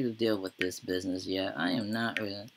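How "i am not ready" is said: usual speaking voice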